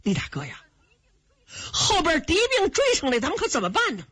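mildly worried appeal; a touch of urgency in the question; anxious. A narrator's reading. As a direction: call out to a big brother, worried. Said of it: "哥俩着急呀".

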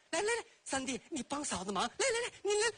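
high eager beckoning; sugary scheming coax; coaxing sister-in-law. Acted. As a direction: rise up high to wave him over. Sister-in-law coaxing him along.

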